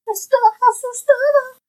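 scared voice. Acted. Reading the scared sheep page.

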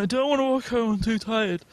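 high whining complaint; whiny drunk. Retold or imitated. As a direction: whine like a tired child. Drunk refusing to walk.

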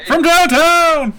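high, loud, slow hype shout; announcer hype call. Pretend. Hyping a long-range hit.

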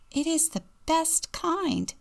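put-on high-pitched small child; high kid voice, lightly acted; little boy's voice. A narrator's reading. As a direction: pipe up like a proud little boy. Story reader voices little Robbie.

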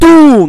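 shouted kaboom sound effect; loud explosion noise. Pretend. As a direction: blast it out like an explosion.

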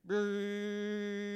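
a buzzing alarm tone made with the lips; imitated phone alert buzz. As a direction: buzz like every phone going off at once. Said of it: "And you hear".

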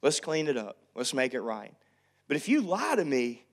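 normal talking voice

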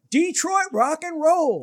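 high, slow, drawn-out announcer call; radio announcer. Retold or imitated. Radio DJ announcing the bands, slow and high. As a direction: boom it like a radio DJ. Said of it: "they go".

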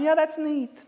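high-pitched mock indifference; mock bored reaction. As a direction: pretend to be barely impressed. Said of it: "don't just hear that and yawn".

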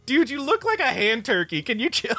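put-on high, unimpressed girl reply; squeaky teen brushing him off; high mocking voice. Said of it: "it's like".

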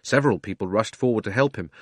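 plain reading voice

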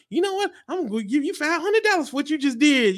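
high, eager voice of a delighted fan; excited offer. Retold or imitated. Fan excitedly offering the comedian cash, excited. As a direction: sound thrilled to hand over cash. Imagined generous audience member.

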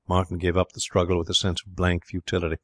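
ordinary delivery, nothing marked

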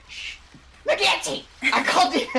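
mock whisper-shout. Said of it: "Ren just like".

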